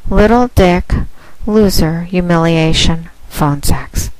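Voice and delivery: ordinary talk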